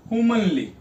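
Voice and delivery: teacher's model "हुमनली", front-stressed on "हु". Shown as a demonstration. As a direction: lean on "हु" in "हुमनली", then let "मनली" fall. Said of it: "हु पे ही ज्यादा जोर आ रहा है".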